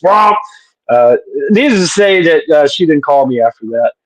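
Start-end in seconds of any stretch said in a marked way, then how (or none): 0.0-0.4 imitated fart noise. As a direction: pop out a cartoon fart. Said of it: "there she was, just".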